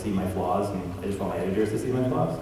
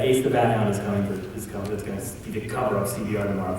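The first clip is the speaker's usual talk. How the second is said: ordinary talk, nothing marked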